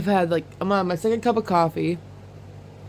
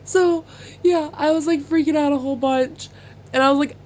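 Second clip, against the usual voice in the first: usual speaking voice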